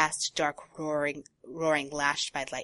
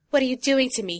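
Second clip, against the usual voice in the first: tearful; 7 semitones higher, 7 dB louder than usual. Acted. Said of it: "he asked the crow tearfully".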